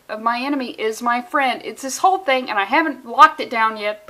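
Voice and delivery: ordinary talk, nothing marked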